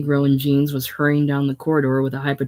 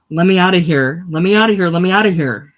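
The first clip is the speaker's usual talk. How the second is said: loud, higher, panicking plea, repeated; panicked scream; 3 semitones higher, 7 dB louder than usual. Acted. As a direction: scream to get out, losing control. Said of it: "a shrill voice ... rose to panic".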